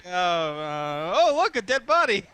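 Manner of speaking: mock oblivious. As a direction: pretend not to notice, high and bright. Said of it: "It's like".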